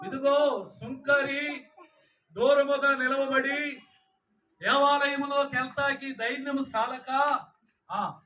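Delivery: loud, slow, high preaching proclamation; preaching at full force. Acted. Preacher proclaiming the tax collector story, loud and slow. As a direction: declaim each phrase slowly and loudly to the congregation.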